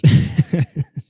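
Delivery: high burst of laughter; loud laugh. Real, not acted. Laughs at own outburst.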